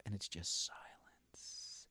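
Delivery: slow, whispered drop for the dead silence; slow dramatic whisper; hushed. Real, not acted. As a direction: let the silence land in a whisper. Describing bombing on stage.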